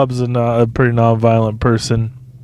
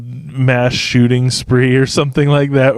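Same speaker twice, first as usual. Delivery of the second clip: ordinary talk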